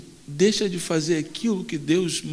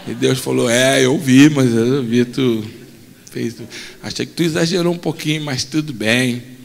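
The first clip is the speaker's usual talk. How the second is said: low God voice; 7 semitones lower than usual. Acted. God questioning Moses quietly.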